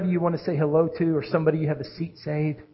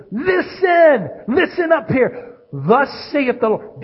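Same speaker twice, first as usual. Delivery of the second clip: high, loud call to listen; changed, commanding tone; 8 semitones higher, 5 dB louder, slower than usual. Acted. God suddenly changing his tone to be heard, commanding. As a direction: raise the voice sharply to command attention. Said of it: "changing the tone of his voice".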